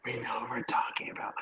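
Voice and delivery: hushed, voiceless whisper. Real, not acted. Podcast guest hinting who is meant from own house, whispering. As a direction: whisper it as if someone at home might overhear. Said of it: "Why are you whispering".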